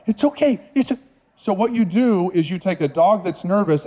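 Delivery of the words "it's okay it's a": high anxious it's okay; freaked-out reassurance. Shown as a demonstration. Trainer mimics nervous owner soothing rescue, high and anxious. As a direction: fret over a scared dog, voice up high. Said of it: "I sound like I'm freaked out".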